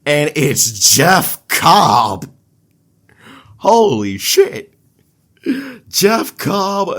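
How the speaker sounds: thrilled wrestling fan reacting to a debut; excited reveal. Real, not acted. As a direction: sell the shock of the surprise debut.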